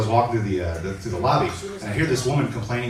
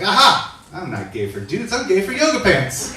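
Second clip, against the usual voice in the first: triumphant realisation; 6 semitones higher than usual. Acted. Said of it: "And I was like".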